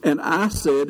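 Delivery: ordinary talk, nothing marked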